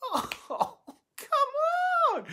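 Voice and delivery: very high, squealed laugh-cry; real overjoyed cry, pitched right up; overcome with delight. Real, not acted. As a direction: let it burst out in a high, emotional squeal. Preacher overcome by his own story.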